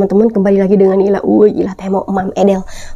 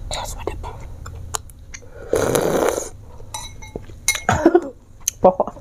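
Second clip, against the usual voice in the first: soft unvoiced whisper; 12 dB quieter than usual. Real, not acted. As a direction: whisper it close to the mic. Whispering while eating on camera.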